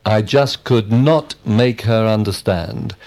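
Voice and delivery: "not" stressed with emphasis. Shown as a demonstration. Student giving a negative example, stress on "not". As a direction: punch the word "not". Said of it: "we emphasize the word not".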